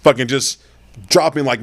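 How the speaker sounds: ordinary talk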